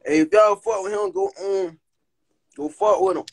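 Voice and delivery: normal talking voice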